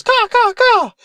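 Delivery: very high squawking crow; crow caws. Acted. Crow answering its questioner, cawing. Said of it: "And then Crow goes".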